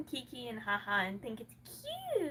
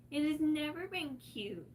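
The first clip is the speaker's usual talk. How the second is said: usual speaking voice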